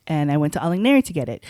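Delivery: usual speaking voice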